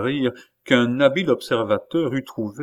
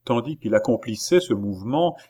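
ordinary delivery, nothing marked

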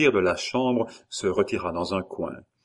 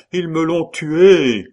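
very loud, high, slow wail; bereaved father's drawn-out cry; grief-stricken outcry; 9 semitones higher, 10 dB louder, slower than usual. A narrator's reading. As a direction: wail it out slowly in anguish. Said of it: "répéta le baron".

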